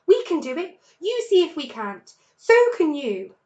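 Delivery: high, loud, eager shout; cried. A narrator's reading. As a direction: cubs leaping to prove themselves. Cubs rallying father.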